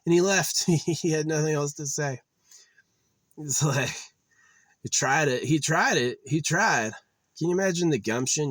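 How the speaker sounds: high, loud gleeful retelling; excited laughing. Real, not acted. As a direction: crow about the guy backing off.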